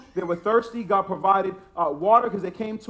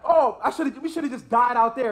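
higher, mock complaining voice of the grumblers; mocking whiny Israelites; 5 semitones higher, 4 dB louder than usual. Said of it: "They're going back".

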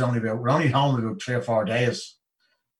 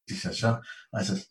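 usual speaking voice

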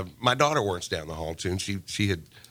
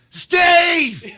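loud high shout down the hall; retold yell, loud and high; yelling a name; 9 dB louder than usual. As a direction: call out Steve across the office. Coworker yelling from desk.

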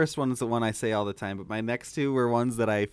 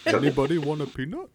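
Andre the Giant impression; 2 semitones higher than usual. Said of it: "Jason Segel's is perfect".

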